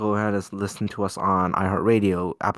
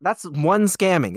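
"one" punched high in a slow, raised line; emphatic clarification; 6 semitones higher, 3 dB louder, slower than usual. Real, not acted. Teller correcting the friends' count, stressing "one". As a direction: lean hard on "one", drawing the line out. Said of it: "I just wanna make that clear".

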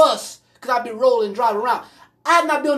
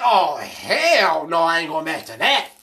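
mock refusal; 7 semitones lower than usual. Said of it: "she be like".

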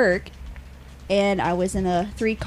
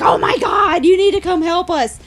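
1 panicked coworkers; 9 semitones higher, 7 dB louder than usual. Retold or imitated. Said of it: "were like".

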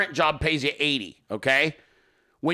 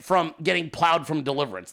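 normal talking voice

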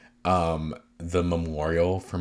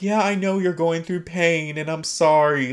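lightly imitated castmate, higher pitch; higher-pitched retold apology, lightly acted; 9 semitones higher than usual. Podcaster retells castmate's apology to her friend, lightly acted higher voice. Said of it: "Meredith is like".

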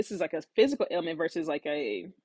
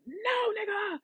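high-pitched mock protest; retold panicked refusal; 4 dB quieter than usual. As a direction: cry out in a high, hammed-up refusal.

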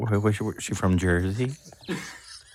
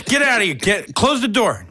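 mock shouting him out; 14 semitones higher, 5 dB louder than usual. Shooing green tea guy out.

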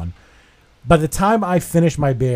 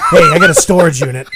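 louder joke offer through laughter; laughing mock offer; 4 semitones higher, 8 dB louder, faster than usual. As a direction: pitch the gag while cracking up. Riffing on the garage joke.

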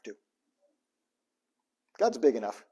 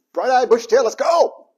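loud, high, hammed-up get-up-and-go; mock eager cheer; 8 semitones higher, 10 dB louder than usual. Preacher mocking the eager Monday riser, hammed-up cheer. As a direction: fake Monday enthusiasm, pumped.

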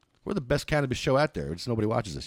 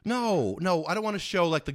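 ordinary delivery

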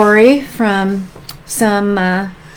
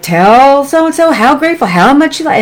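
higher, mock-insistent parent; acted parental prodding; 6 semitones higher, 4 dB louder than usual. Parent pressing kids to thank someone, mock insistent. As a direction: prod a child to say thanks, playfully overdone.

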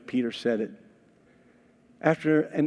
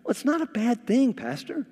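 timid bride; 10 semitones higher than usual. Retold or imitated. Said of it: "just kinda timid".

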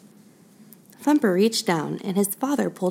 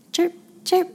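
put-on very high tiny chirps for baby birds; chirping birdies; 12 semitones higher than usual. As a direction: chirp like little chicks.

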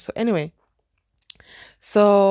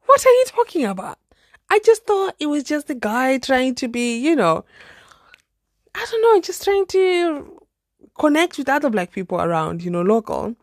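shocked disbelief; 6 semitones higher, 3 dB louder than usual. Retold or imitated. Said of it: "I was like".

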